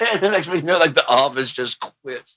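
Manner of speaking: ordinary delivery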